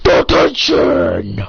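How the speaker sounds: low, loud, drawn-out roar; deep authoritarian shout; booming detention order. Acted. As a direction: bellow the punishment slowly. Teacher hands down punishment.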